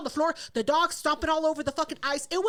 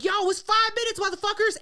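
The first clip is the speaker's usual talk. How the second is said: raised, higher party shout; calling out; 6 semitones higher, 4 dB louder than usual. Retold or imitated. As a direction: holler a time warning to the room.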